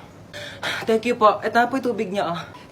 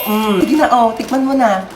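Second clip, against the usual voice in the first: usual speaking voice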